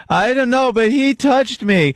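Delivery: put-on high, slow little girl accusing; lightly voiced small child, drawn out.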